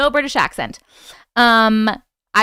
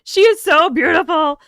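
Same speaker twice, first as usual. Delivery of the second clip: loud, higher-pitched gushing; gushing admiration; 5 semitones higher, 6 dB louder than usual. Real, not acted. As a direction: exclaim it with open adoration. Gushing aloud over the photo.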